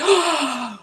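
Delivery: a sharp, high gasp of surprise; startled gasp. Retold or imitated. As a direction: gasp as if the thing in her hand just changed. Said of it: "she just kinda looked at it like".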